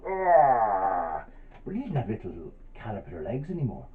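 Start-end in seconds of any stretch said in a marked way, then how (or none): 0.1-1.2 long, loud open-mouthed "arrgh" yawn; big pretend yawn. Storyteller showing children how to make a big yawn, exaggerated yawning. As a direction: stretch out a huge mock yawn. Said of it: "make a big yawn".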